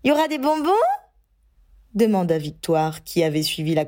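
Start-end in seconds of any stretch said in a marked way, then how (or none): 0.0-0.7 put-on high, eager child voice; little girl. Little Victoire asks the grown-ups about sweets, high and eager. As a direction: ask like an excited little girl.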